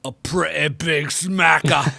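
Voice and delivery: mock British accent. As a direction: savour the British phrase in a hammed-up English accent. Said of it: "I wish I had a cool accent like that".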